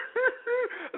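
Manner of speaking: high whimpering fake sob; a hammed-up wail between words; mock sob. Mocking the crying victim, fake high sob. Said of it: "she was sitting there crying".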